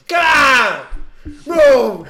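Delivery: high, loud mock screaming through laughter. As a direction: play-act a screaming driver. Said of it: "He's just screaming".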